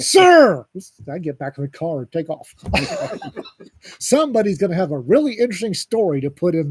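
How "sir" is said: high, loud, drawn-out yell; yelling after someone. Retold or imitated. Operator shouts after the bystander driving off, loud drawn-out yell. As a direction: holler it to stop someone leaving.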